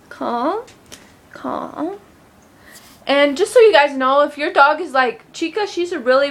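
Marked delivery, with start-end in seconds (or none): 0.1-2.0 soothing the dog. Real, not acted. Settling the excited dog.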